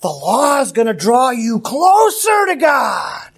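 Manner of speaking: lightly acted legalist sales pitch; legalists' promise. Legalists selling the law to the Galatians, mock promise. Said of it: "they probably told them".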